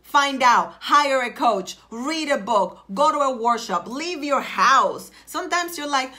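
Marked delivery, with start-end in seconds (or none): none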